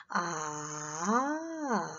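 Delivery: a low dipping 아 of sudden understanding; third tone 아. Shown as a demonstration. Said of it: "이런 톤이랑 좀 비슷하답니다".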